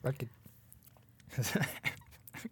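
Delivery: talking through a mouthful; words round a bite of cookie; mouth full. Real, not acted. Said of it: "talk with a mouthful mouth".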